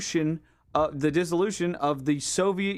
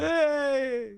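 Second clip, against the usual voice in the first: hammed-up Putin shout; Putin impression; 8 semitones higher than usual. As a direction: bark it as a mock Putin. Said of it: "he'll come out and do Putin".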